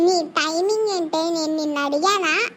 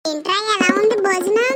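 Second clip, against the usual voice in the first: ordinary talk, nothing marked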